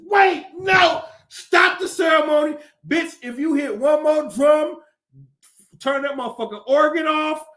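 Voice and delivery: outraged churchgoer yelling at the Pope; mock outraged shout. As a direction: stop the ceremony in a hammed-up yell. Imagined outburst at the baptism.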